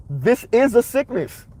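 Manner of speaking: high, slow put-on Haitian accent; drawn-out Haitian-accent imitation. Shown as a demonstration. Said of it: "in a Haitian accent".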